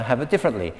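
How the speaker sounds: ordinary talk, nothing marked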